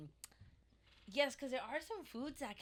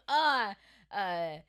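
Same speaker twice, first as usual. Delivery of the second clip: mock French accent, loud, laughing at the end; 4 semitones higher, 8 dB louder than usual. Friend asked for a French accent, mock French. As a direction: play a dreamy Frenchman. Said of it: "Can you give me a French accent".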